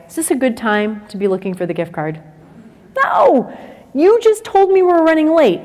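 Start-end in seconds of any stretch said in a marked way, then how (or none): 3.0-5.6 much higher, pointed exasperation; exasperated mom. Acted. Mom answering the teen's question, exasperated high voice. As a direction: answer like a fed-up parent.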